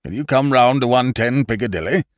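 put-on fussy elderly gentleman; courteous old lawyer, fussing; older man voice. A narrator's reading. Lawyer on the telephone.

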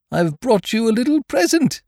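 high-pitched, eager bear voice; excited. A narrator's reading. Pooh bringing Eeyore a present, excited. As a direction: announce it eagerly, pitched right up. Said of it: "said Pooh excitedly".

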